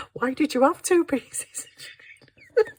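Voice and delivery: high, slow question cracking with laughter; laughing son. Retold or imitated. Son mocking his mum, laughing all through. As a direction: squeeze it out through giggles. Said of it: "And he's like".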